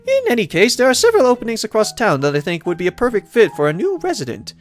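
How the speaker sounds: high-pitched, welcoming lady mayor voice; pitched well up, pleased to see the newcomer; cheerful mayor. Acted. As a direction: voice a delighted mayor greeting a newcomer.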